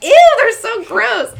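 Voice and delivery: high, loud, drawn-out squeal of revulsion; slow, shrieky mock horror; hammed-up disgust. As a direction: replay being totally grossed out, very loud. Retold disgust, shouted over bug.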